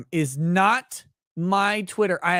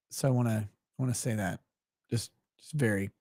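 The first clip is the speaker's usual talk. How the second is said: ordinary talk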